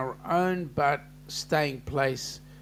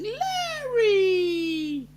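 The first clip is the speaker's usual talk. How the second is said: squealed name, very high; a shrill-free but very high delighted call of Larry; excited call. Retold or imitated. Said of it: "Danny's going".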